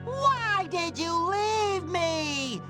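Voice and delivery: high, drawn-out wail; melodramatic lament. Acted. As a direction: wail it slowly, hammed-up heartbreak. Laments being abandoned.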